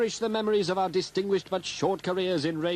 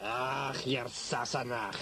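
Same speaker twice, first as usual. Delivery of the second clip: low, soft and slow stage Scot; man at the door with a terrible accent, in mock Scottish; 8 semitones lower, 6 dB quieter, much slower than usual. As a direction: growl it like a comic Highlander. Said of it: "a man with a terrible accent".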